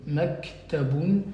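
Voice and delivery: "Maktaboun" said slowly, syllable by syllable; slow clear model word. Shown as a demonstration. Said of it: "qui veut dire bureau". (prononcer « Maktaboun » lentement, syllabe par syllabe)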